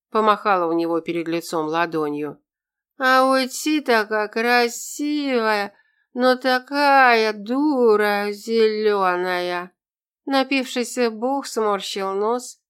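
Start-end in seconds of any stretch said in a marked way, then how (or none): 3.0-9.7 put-on slow, high drunken babble; drunk rambling. (пьяная болтовня)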